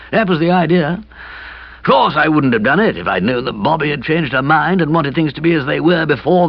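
sheepishly explaining a blunder; gloomy young man voice. A narrator's reading. Kipper rueful.

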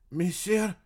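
usual speaking voice

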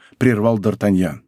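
plain reading voice

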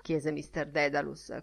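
ordinary delivery, nothing marked

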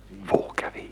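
hushed whispered question. Acted. Fy asks Jaffa in the dark dining room, whispering. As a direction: breathe it, afraid to wake anyone. Sneaking onto piano at night.

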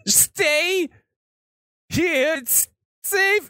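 very high, urgent guide voice; high-pitched strained command; alarmed warning. Acted. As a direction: order them to stay put in rising panic. Guide warns party as ground shakes.

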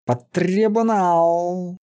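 very slow, high growled sentence; drawn-out menacing roar; threatening beast-man. A narrator's reading. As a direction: stretch the threat out. Beast-man threatens the lifted sentry.

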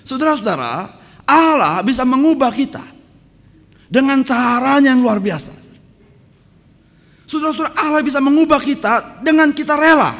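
preacher proclaiming that God changes us; preaching at full force. Acted. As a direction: proclaim it to the whole church.

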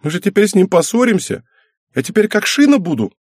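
put-on high girlish whining lament; acted weepy, upset daughter; tearful wail. A narrator's reading. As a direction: wail it childishly, voice way up. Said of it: "вновь зашмыгала носом означенная девочка".

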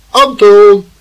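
loud, high call of a name. Acted. Uncle calls Anton to come downstairs, calling out insistently. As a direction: summon a dawdling child.

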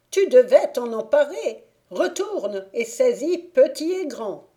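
angry fairy berating her servant; furious scolding. Acted. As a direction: tear into the failed squire.

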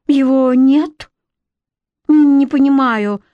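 stunned, can't believe it; shocked disbelief. A narrator's reading. Shocked, the spindle is gone.

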